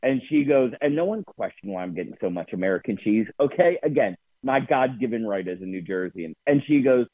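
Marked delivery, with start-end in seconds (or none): none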